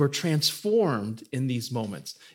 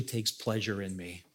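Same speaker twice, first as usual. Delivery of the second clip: tender hush; 8 semitones lower, 12 dB quieter than usual. Real, not acted. Personal confession of God's delight.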